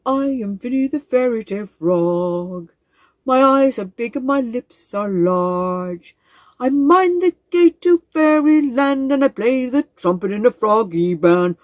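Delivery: slow, booming, stately frog voice; a proud old frog greeting his visitors; grand wise frog. Acted.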